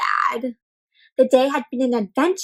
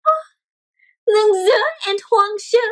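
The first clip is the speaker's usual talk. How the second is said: tender reunion; 9 semitones higher, much slower than usual. A narrator's reading. Reunion of bicycles.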